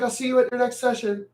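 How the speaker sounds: lightly voiced dismissive therapist; mocking therapist. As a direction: imitate a shrink waving them out the door. Mocking therapists' brush-off again.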